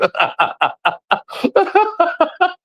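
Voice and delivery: high-pitched burst of real laughter; long laugh. Real, not acted. Cracking up at his own stupid joke, long laugh.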